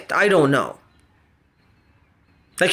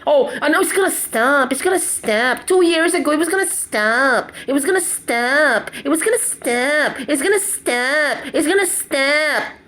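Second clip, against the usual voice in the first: lightly mimicked sing-song reassurance, higher; mocking promise; 3 semitones higher than usual. Vlogger mocking promises he keeps hearing, lightly mimicked. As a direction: parrot the empty promise they keep giving. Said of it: "That's all I'm hearing".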